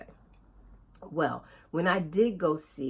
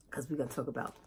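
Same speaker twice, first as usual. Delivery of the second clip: ordinary talk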